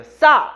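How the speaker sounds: 싸 hit hard with a tense double ㅆ; strong tense consonant. Shown as a demonstration. Teacher showing how old clusters were read, a forceful tense 싸. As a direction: punch 싸 out strongly. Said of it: "뒤에 거 세게 읽었어요".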